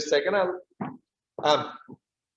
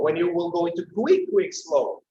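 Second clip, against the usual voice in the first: ordinary talk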